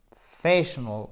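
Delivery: PROFESSIONAL stressed on FE, ending reduced; accent tonique. Shown as a demonstration. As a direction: lean on FESS in "Professional", swallow the rest. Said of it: "Accent tonique". (Professional, accent sur FE, fin réduite)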